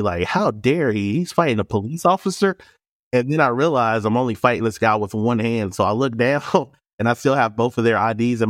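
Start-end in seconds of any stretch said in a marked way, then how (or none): 0.0-2.6 outraged "how dare he", rising into disbelief; indignant disbelief. Retold or imitated. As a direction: stretch it out, scandalized. Indignant inner reaction to being fought.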